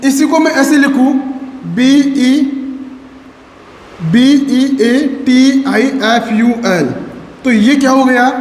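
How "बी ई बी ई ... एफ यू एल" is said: letters of beautiful spelled slowly in the wrong order; slow, careful incorrect spelling. Shown as a demonstration. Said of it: "incorrect spelling हो गई".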